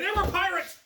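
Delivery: put-on high, loud breathless girl's report; mock-excited girl shouting about pirates. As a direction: squeal it like a thrilled witness. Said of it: "and they said".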